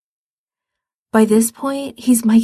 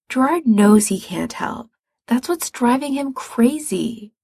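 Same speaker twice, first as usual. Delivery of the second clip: usual speaking voice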